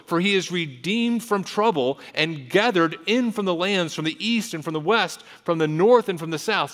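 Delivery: preacher declaiming scripture; impassioned scripture reading. Acted. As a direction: proclaim the psalm with force.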